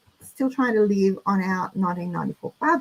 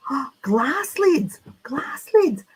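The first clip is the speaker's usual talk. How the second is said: excited whisper; 3 semitones higher, slower than usual. Real, not acted. Excited whisper over glass lids.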